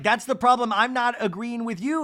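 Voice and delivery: high, loud mock squabbling; Angie. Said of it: "And Angie's like".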